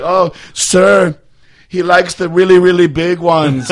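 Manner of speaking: high, loud put-on gossip voice; gossiping cleaning lady. Imagined cleaning lady tells her coworker about his taste, high and loud.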